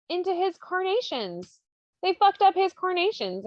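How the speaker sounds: high-pitched comic indignation; mock outrage. Narrator relays the gardener's flower grievance, high mock outrage. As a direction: wail lightly over a small disaster.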